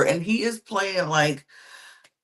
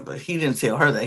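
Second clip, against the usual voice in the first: usual speaking voice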